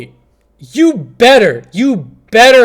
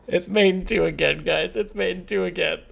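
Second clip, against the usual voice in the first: soft, laughed-out voice; weak laughter; 3 semitones lower, 12 dB quieter than usual. Real, not acted. Streamer telling guys it's mate in two again, weak laughter. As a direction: say it quietly, spent from laughing. Helpless laughter at repeated blunder.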